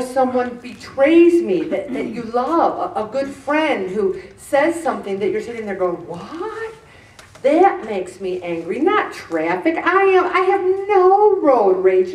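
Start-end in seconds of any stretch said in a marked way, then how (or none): 6.1-6.7 high, hushed rising question; quiet squeak of disbelief; stunned disbelief. Acted. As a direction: ask it softly, pitched up in shock. Stunned at a friend's betrayal.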